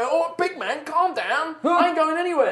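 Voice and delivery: high-pitched alarmed workman; protesting carpenter. Acted. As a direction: plead for calm while being lifted. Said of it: "he's like".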